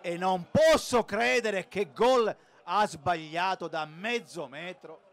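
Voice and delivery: lively football commentary; live play-by-play. Acted. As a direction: call the action as it happens.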